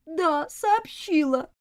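high, slow whine; whining. A narrator's reading. Girl answers her governess about the news, whining. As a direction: drag it out like a sulky child. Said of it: "заныла Элизабет".

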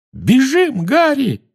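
high, loud shriek. Acted. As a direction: shriek in alarm. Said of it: "взвизгнула Гермиона".

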